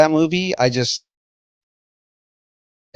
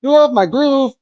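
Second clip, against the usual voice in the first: high, loud sing-song Kuzco; high mock Kuzco voice; 12 semitones higher, 5 dB louder than usual. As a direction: belt it like a vain emperor. Quoting Emperor's New Groove.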